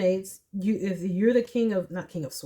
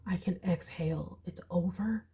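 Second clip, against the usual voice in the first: relieved whisper; 9 dB quieter than usual. Retold or imitated. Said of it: "you're like".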